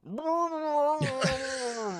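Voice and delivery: sudden high cry of pain, retold; pained yelp underwater. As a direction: let out a startled, hurt yelp. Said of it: "I hear him go".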